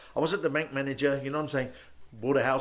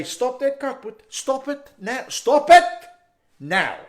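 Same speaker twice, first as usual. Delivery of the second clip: stern order building to a shout; scolding father; 8 semitones higher, 8 dB louder, slower than usual. Acted. Dad catching his son out, scolding ever louder. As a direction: raise pitch and volume with each command.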